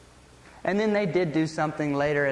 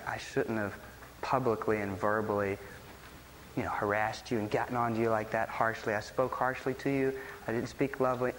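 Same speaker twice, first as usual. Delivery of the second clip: low, soft re-enacted apology; humble apology; 8 semitones lower, 7 dB quieter than usual. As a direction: quietly ask your child's forgiveness. Retold apology to child at bedtime.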